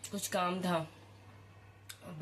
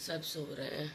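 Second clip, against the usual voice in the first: much softer, almost hushed; speaking softly; 3 semitones lower, 7 dB quieter than usual. Real, not acted. Mother at six a.m. while everyone sleeps, hushed. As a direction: drop the voice so nobody wakes. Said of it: "मैं धीरे धीरे बोल रही हूँ".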